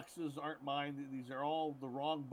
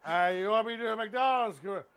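loud, high, slurry mock drunk actor; hammed-up drunk celebrity; drunk Brian Cox; 4 semitones higher, 9 dB louder than usual. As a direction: play a tipsy star agreeing to an ad. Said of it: "He's drunk doing this commercials".

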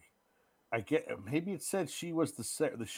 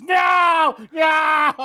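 high, loud hammed-up scream of refusal; mock scream; 13 dB louder than usual. Acting out the villain seeing the baby, mock screaming. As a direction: wail it at full pitch, playfully overdone.

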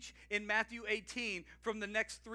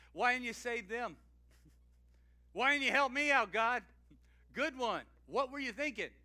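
a touch louder, higher, mock-accusing God; cynical questioning; 4 semitones higher, 7 dB louder than usual. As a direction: lightly act out a jaded complaint to God. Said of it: "cynical with our questions".

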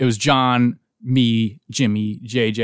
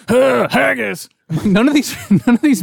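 badly faked Scot, pitched way up; dad's bad Scottish impression; 10 semitones higher, 3 dB louder than usual. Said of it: "he's Scottish, you know, all like".

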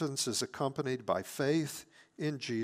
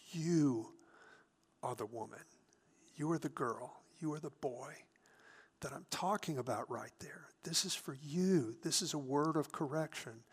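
whisper; 6 dB quieter than usual. Retold or imitated. The Holy Spirit whispering to a Proverbs reader, hushed whisper. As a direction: speak very softly, as a private word of conviction. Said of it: "the Holy Spirit will whisper to you".

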